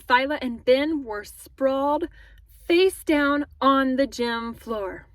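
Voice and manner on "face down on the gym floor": ordinary delivery, nothing marked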